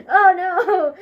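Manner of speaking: high, loud, laughing wail; mock panic. Child caught out at the table, hammed-up laughing panic. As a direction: wail it in comic dismay. Acts out panic at being found out.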